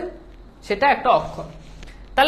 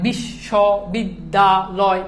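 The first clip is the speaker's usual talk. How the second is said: বিশ্ববিদ্যালয় said slowly, piece by piece; broken into syllables; 4 semitones lower, much slower than usual. Shown as a demonstration. Teacher showing the class what a syllable is, বিশ্ববিদ্যালয় broken up slowly. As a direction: chop বিশ্ববিদ্যালয় into small separate syllables with gaps between. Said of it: "আমি যে ভেঙে ভেঙে".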